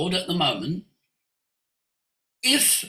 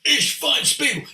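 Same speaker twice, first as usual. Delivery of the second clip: Hitler; 8 semitones higher than usual. Retold or imitated. Speaker listing voices on the satellite, mock ranting dictator. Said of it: "You'll have Hitler".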